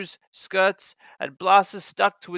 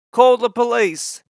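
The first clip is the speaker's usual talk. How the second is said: higher, louder angry shout; 6 semitones higher, 6 dB louder than usual. A narrator's reading. Passengers shouting angrily about the damage. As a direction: demand it angrily. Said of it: "they shouted angrily".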